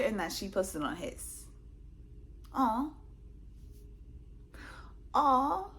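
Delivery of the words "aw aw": high, sarcastic cooing; fake-touched aww to mock him. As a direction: pretend to be moved by his deletions. Sarcastic at deleted comments.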